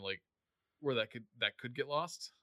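ordinary delivery

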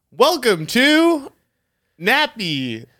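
slow, high, stretched show opener; drawn-out intro. Pretend. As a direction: drag the words out like a host opening the show. Second try at the intro.

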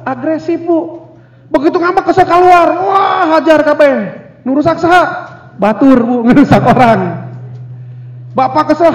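usual speaking voice

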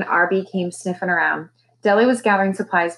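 ordinary delivery

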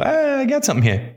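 high-pitched mock eureka moment; perked-up discovery. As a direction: jump high, as if onto something. Acts the flip to optimism.

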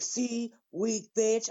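retold high-pitched sneer; imitated taunt. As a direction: mimic him goading her.

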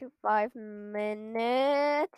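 ordinary delivery

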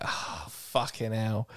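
soft, slow "Oh, fucking hell" under the breath; deflated groan. Retold or imitated. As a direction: sink into disappointment. Deflated after missed song.

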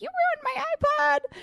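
high, slow, squealing outrage at the ruined iPod; a hammed-up wail of indignation; retold angry shriek. To her drunk friend Kenny, a high mock-furious shriek. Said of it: "I was so pissed".

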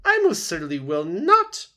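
high, very loud indignant goose; squawking refusal. A narrator's reading. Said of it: "squawked the goose".

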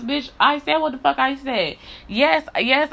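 ordinary talk

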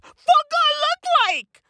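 high, slow, squeaky "what do I look like"; high squeaky indignation. Acted. Comic telling the audience he is insulted, high, slow squeak.

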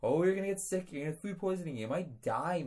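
high, whiny imitation of worried doubters; mock worried critics. Host mocking listeners who fear salmonella, high whiny voice. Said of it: "some may be thinking".

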